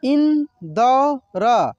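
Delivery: इंदौर stretched very slowly as इं-दौ-र; slow syllable by syllable. Shown as a demonstration. Teacher sounds out the word slowly for students. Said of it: "कैसे इसको पढ़ेंगे".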